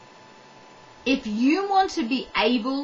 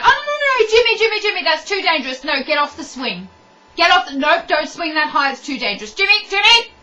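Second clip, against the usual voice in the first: loud, high, rapid panicked fussing; helicopter parent; 4 semitones higher, 6 dB louder, faster than usual. Acted. Helicopter parent to Jimmy on the swing, panicked. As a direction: fret loudly over a child in danger. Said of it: "you've got these helicopter parents".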